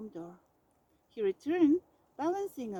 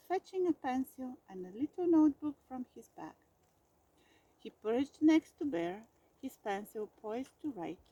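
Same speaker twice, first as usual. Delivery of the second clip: plain reading voice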